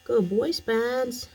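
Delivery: high, slow sing-song praise to a dog; pet praise voice. Real, not acted. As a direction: coo it slowly to the puppy.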